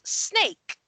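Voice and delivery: high-pitched alarmed cry; yelling. Acted. Horse closest to the snake, yelling in alarm. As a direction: yell it out as a warning. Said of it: "closest to you yells".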